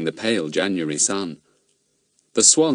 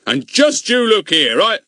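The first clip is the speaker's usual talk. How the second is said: louder angry policeman; a touch of fury, cut off; 14 semitones higher, 7 dB louder than usual. A narrator's reading. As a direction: policeman starts a furious warning. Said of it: "roared the angry policeman".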